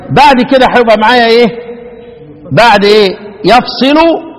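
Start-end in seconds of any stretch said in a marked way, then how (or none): 3.5-4.3 يفصل said "yafsilu"; single s, "ya" first in يفصل; يفصل as "yaf-si-lu"; Asim's light reading. Shown as a demonstration. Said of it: "يفصل هو عاصم لوحده".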